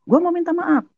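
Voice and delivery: high, pushed pleading; wide-eyed insistence. Retold or imitated. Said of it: "Sampai melotot mata saya".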